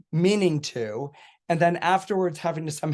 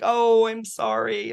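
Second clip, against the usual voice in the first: higher, drawn-out fake apology; mock sympathy; 7 semitones higher, slower than usual. To the crying friend while secretly unrepentant, drawn-out mock sympathy. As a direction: coo a slow, insincere sorry.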